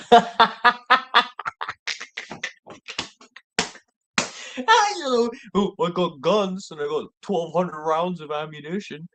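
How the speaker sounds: high, boastful mock Texan; bragging Texan. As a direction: puff up and brag about guns, pitched high. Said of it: "Texan quoted".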